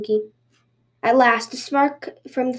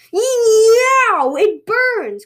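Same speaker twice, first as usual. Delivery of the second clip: high, loud drawn-out yowl of pain; pained cry; 11 semitones higher, 7 dB louder, much slower than usual. Acted. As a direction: cry out as if scalded. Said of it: "cried the tanuki".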